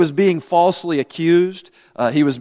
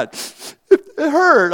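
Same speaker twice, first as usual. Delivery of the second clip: slow, high mock sob; hammed-up tearful whimper; mock crying; 9 semitones higher than usual. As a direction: play up the old hurt with a weepy wail. Pretends lingering hurt over childhood teasing.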